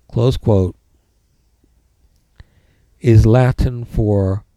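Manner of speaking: slow, clear dictation with pauses; dictating. Shown as a demonstration. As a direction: read out each punctuation mark. Dictating notes to the class.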